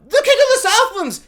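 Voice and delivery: high, loud heroic announcement; hammed-up royal fanfare shout; mock grand proclamation. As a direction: bellow it like a pompous herald. Mocking Halbrand's announcement again.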